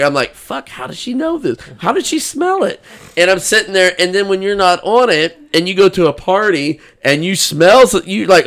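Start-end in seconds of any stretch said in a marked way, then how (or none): 0.3-2.8 high, hushed inner-voice alarm; mock panic. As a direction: replay a baffled panic, pitched way up and softer. Retold panic at wife catching him.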